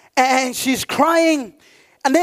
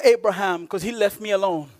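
ordinary delivery

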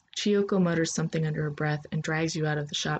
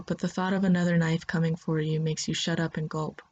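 ordinary delivery, nothing marked

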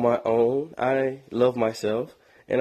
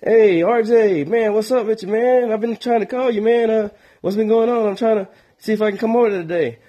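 high, loud pushy buddy; a chatty friend who wants to come over; eager friend calling; 8 semitones higher, 4 dB louder than usual. Retold or imitated. Friend calling to get studio time, high and loud. Caller voiced in phone story.

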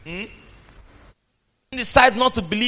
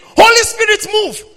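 high, loud preacher's cry; shouting minister; 13 semitones higher, 8 dB louder than usual. Retold or imitated. Preacher imitates the minister crying out to the Holy Ghost, loud and high. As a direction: call on the Spirit like a fiery minister.

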